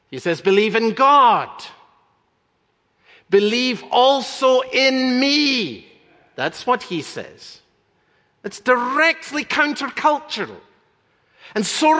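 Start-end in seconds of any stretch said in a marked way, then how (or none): 0.4-5.9 loud, slow, high proclamation of the words of Jesus; proclaiming loudly. Retold or imitated. Preacher to congregation, quoting Jesus in a slow ringing proclamation. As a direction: ring it out like a call from the pulpit.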